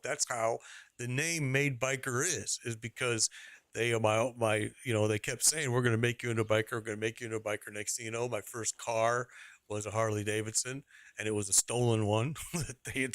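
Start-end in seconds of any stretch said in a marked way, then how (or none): 5.7-7.7 rapid retold refrain. Retelling what the bikers kept saying, rattled off fast. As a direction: rattle off "we're gonna make you into a biker" fast.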